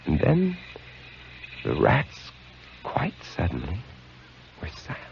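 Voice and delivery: falling to a near whisper; hushed suspense. Acted. Keeper telling how the rats went silent, hushed. Tense moment in the story.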